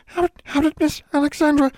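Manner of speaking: very high elf voice, stumbling over words; stammering house elf. A narrator's reading. Elf stammering at Alexandra in shock, very high. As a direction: stutter it out, tiny and scared. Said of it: "She stammered".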